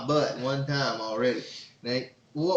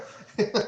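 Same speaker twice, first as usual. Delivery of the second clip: breathy laugh; 10 semitones higher than usual. Real, not acted. Laughing after his movie quote.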